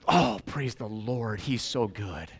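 soft, slow modest praise; gently humble, quiet; humble pious reply. Retold or imitated. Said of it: "And I go".

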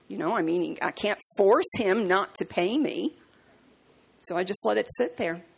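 ordinary talk, nothing marked

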